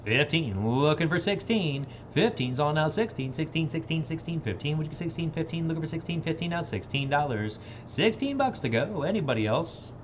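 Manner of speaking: rhythmic auction chant; auctioneer. Acted. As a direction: rattle off the bids.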